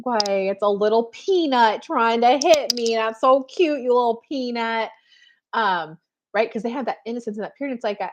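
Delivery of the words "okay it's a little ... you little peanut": put-on doting baby talk. Adult to a little one trying to hit, doting coo. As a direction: coo at an adorable toddler. Adult cooing at a hitting toddler.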